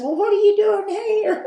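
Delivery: high, loud startled question; surprised squeal. Retold or imitated. Shop owner startled when a reporter walks in, high surprised cry. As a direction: jump up in pitch, caught off guard.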